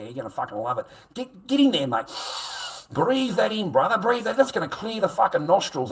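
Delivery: loud, high, gloating jeer; loud mocking taunt at a mate. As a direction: rub it in his face, crowing. Shoving fingers in someone's face.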